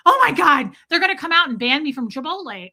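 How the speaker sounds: loud, high burst of alarm; retold sudden dread, hammed up; mock panic. Customer fearing a ban after her outburst, mock panic.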